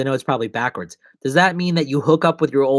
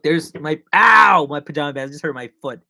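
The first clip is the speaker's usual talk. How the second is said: normal talking voice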